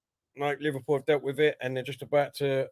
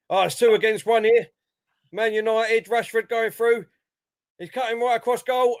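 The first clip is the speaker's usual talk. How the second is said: raised, louder commentator voice; mock play-by-play, pitched up with urgency; imitating match commentary; 6 semitones higher, 4 dB louder than usual. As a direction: call the attack like an excited commentator. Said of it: "That's what he does that and all sometimes".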